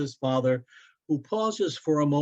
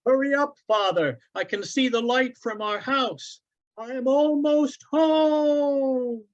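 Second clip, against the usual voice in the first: put-on high, louder excited child's call; 12 semitones higher, 4 dB louder than usual. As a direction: shout ahead to father, thrilled. Boy calling from ahead.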